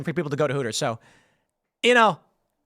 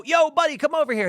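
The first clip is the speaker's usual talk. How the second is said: calling out; 9 semitones higher than usual. Retold or imitated. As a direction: hail a guy walking by from afar. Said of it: "and I go".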